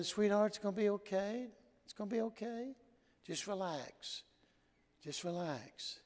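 put-on calm a frightened little girl gently; soothing a child. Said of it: "and I'm calming her down".

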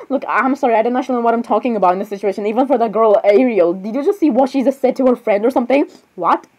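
usual speaking voice

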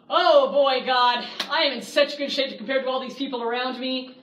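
loud, pleased-with-himself bragging to God; smug boasting prayer. Retold or imitated. Pharisee boasting to God in a loud, smug voice. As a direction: crow about your own goodness. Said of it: "We don't come before God saying".

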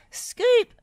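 high, loud shout of a name; sharp high call. A narrator's reading. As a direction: holler for someone. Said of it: "Bob called".

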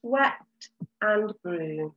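"swept and broom" said very slowly; slow, clear model to repeat. Shown as a demonstration. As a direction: stretch each word for children to copy. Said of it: "Say it".

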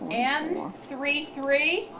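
clear, measured number calling; bingo caller. Acted. Calls bingo numbers to clients.

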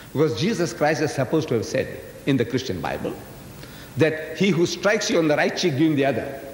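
ordinary talk

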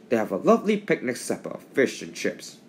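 plain reading voice

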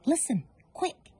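lightly voiced urgent ladybird. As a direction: grab their attention quickly. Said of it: "the ladybird said".

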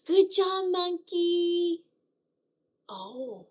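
usual speaking voice

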